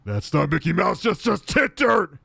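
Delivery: loud, high yell, stretched on the last words; Tourette's Guy. Retold or imitated. Angry dad correcting his son, shouted impression. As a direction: bellow it like an indignant dad. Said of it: "It's the best line".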